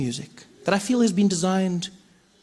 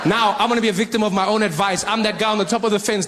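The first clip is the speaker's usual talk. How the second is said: ordinary talk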